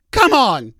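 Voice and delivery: loud, fed-up "come on"; a loud, exasperated cry of disbelief; exasperated outburst. Real, not acted. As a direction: throw up your hands and yell it. Ranting about fart gas neighborhood.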